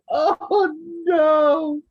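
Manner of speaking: mock panicked yelp; high mock cry. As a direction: yelp as you fall. Retold falling cry.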